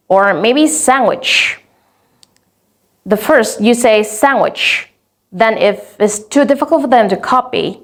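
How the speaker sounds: usual speaking voice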